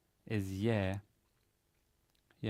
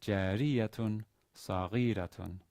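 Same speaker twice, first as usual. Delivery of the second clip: "Jariyatun saghiratun" slowly, leaning on "ri" and "ghi"; slow teaching demo of "Jariyatun saghiratun", third-to-last syllables stressed; slower than usual. Shown as a demonstration. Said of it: "the stress is on the".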